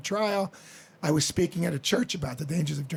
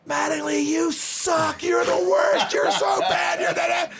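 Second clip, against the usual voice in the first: much higher, louder mock heckling; imitating jeering fans; 10 semitones higher, 5 dB louder, slower than usual. Mimicking Yankee fans booing Mattingly, loud high jeering yell. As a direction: yell it down from the stands like angry fans.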